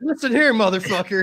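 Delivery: high, loud hammed-up threat; mock tough guy. Friend mocks Scooby confronting the driver, hammed-up threat. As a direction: play the armed tough guy for laughs. Mocking him with the lever action.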